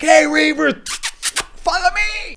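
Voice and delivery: high, ringing taunt rising to the end; calling out. Retold or imitated. Mal calls the Reavers to follow him, high mock taunt. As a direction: call out to lure them, pitch climbing high.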